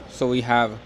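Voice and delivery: usual speaking voice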